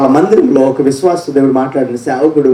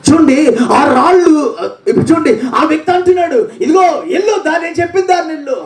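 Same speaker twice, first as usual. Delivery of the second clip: preaching at full force; 11 semitones higher than usual. Acted. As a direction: call it out to the whole church. Preacher shouting, voicing the angel.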